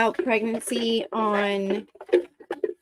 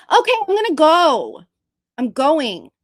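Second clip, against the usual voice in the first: normal talking voice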